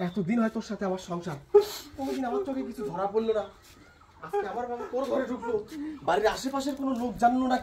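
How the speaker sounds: usual speaking voice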